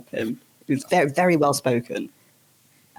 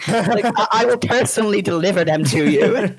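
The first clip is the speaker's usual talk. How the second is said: gushing reply; 6 semitones higher than usual. Retold or imitated. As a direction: gush at the sweet old man. Overly eager reply to sweet man.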